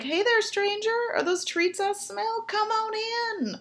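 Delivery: friendly dog voice. Retold or imitated. The dog greeting the killer in a high eager voice. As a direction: chirp it like a dopey welcoming dog. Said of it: "That dog would be like".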